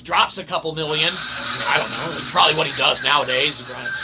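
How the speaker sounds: ordinary talk, nothing marked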